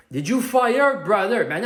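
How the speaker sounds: ordinary delivery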